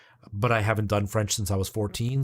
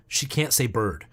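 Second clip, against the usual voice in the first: ordinary talk, nothing marked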